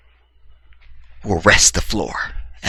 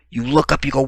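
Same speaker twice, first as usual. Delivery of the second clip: ordinary talk, nothing marked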